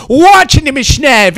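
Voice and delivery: yelled watch ihn nämlich schnell; shouted repeat. Acted. Repeated line, escalating.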